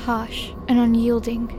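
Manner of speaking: ordinary delivery, nothing marked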